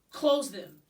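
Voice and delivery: a stern father repeating himself; firm command. A narrator's reading. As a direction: lay down the law to the kid. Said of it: "his father repeated firmly".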